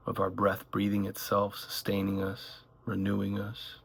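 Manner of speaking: calm meditation voice, gradually softening; unhurried phrases with long silences between them; guided meditation. Acted. Guide leading listeners through one breath at a time, guided meditation.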